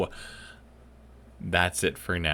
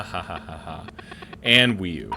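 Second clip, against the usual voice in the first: usual speaking voice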